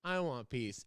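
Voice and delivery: ordinary talk, nothing marked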